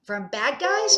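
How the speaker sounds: put-on high, loud excited kid; pumped-up little voice; eager child question. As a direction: ask it like a kid ready to fight. Child eager, fist in the air.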